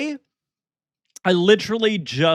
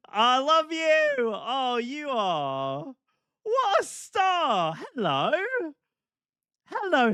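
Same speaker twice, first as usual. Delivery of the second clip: put-on playful gamer girl headset; 8 semitones higher, slower than usual. Gushing high voice to chat.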